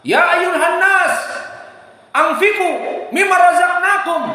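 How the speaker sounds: ringing sermon cry; preacher's shout. Acted. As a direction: call it out to the whole mosque. Preacher calls out the Prophet's words.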